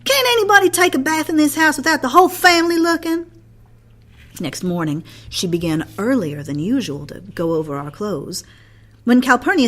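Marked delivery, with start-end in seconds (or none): 0.1-3.3 loud, fast, high indignant protest; a boy exploding at his family's intrusion; outburst. A narrator's reading. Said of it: "provoked an outburst".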